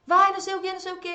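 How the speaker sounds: high, loud mock yell; imitating a mother yelling from the kitchen. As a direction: shout an order from another room. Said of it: "gritando lá da cozinha".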